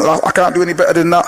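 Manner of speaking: ordinary delivery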